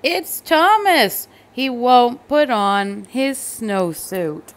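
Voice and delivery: loud, slow tattling teacher; reporting the culprit to the boss; complaining teacher. A narrator's reading. Teacher complains to the principal about Thomas. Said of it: "The teacher said".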